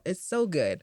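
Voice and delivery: usual speaking voice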